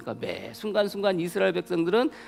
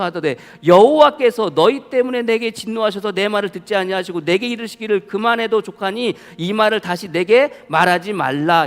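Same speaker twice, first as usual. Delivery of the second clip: forceful scripture reading; 2 semitones higher, 6 dB louder than usual. Acted. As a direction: read the verse out loud and strong, like from the pulpit. Reads Deuteronomy aloud forcefully.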